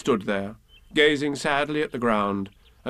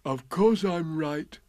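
slow, higher storybook bear; Pooh, sure; 4 semitones higher, slower than usual. A narrator's reading. Bear confirming the loss to donkey, slow and sure. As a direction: state it slowly and surely. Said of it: "said Pooh".

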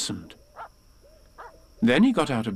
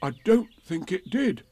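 Pooh; 6 semitones higher than usual. A narrator's reading. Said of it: "said Pooh".

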